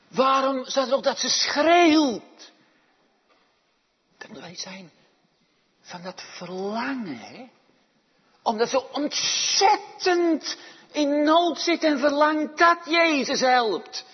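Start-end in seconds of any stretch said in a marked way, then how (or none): 0.1-2.5 loud, high rhetorical question; forceful questioning. Real, not acted. Preacher presses question on shouting. 8.4-14.0 loud, high, urgent pulpit cry; impassioned preaching. Acted. Preaching at full force.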